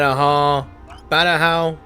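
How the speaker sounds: ordinary delivery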